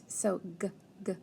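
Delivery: g, g isolated; teacher segmenting get; sound-out. Shown as a demonstration. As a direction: tap out the g sound. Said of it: "And then get".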